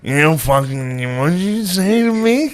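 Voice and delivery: slow, rising into a laugh; mock blacked-out drunk. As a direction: play the confused drunk squaring up. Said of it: "Shaner would just stand there and be like".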